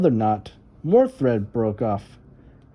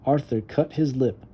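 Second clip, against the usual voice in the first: ordinary delivery, nothing marked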